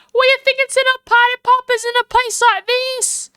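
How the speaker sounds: high, loud Southern drawl. Acted. Angry woman scolds over her wasted film, Southern drawl. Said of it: "I'm so sorry about her accent".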